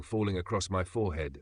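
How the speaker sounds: ordinary delivery, nothing marked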